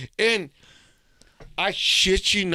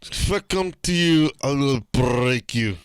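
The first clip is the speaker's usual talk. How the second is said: put-on German accent; 3 semitones lower than usual. Shown as a demonstration. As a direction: threaten in a mock German accent. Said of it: "Say it in your German accent".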